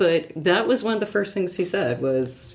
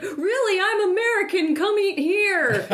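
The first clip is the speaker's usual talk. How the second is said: restaurant host; 12 semitones higher than usual. Retold or imitated. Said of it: "There was a guy who was like".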